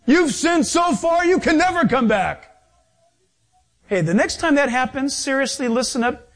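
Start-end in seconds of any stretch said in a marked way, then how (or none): 0.1-2.5 high-pitched, scoffing devil; taunting Satan. Acted. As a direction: scoff like the devil crushing someone's hope. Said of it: "And he goes".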